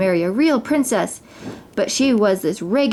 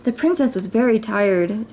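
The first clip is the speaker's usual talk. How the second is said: usual speaking voice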